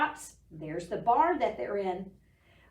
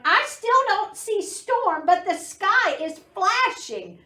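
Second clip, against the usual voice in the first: higher, loud duck call from above; 9 semitones higher, 8 dB louder than usual. Acted. Duck calling down to the animals, loud high call. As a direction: call it down from the window. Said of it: "Duck called down".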